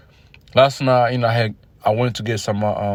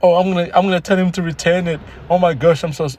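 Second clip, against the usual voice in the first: higher, put-on apologetic woman's voice; mock innocent crackhead woman; fake-innocent chick; 6 semitones higher than usual. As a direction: play the shoplifter pretending she's sorry. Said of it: "the chick was like".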